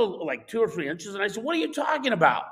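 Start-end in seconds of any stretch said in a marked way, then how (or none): 1.4-2.5 high-pitched, incredulous mock yell; retold yell. Driver out the window to old man, retold yell. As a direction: call it back as a retold protest, pitched up. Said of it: "I yelled out the window".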